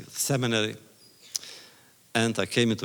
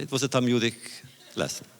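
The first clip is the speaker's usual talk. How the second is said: ordinary delivery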